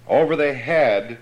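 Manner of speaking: ordinary talk, nothing marked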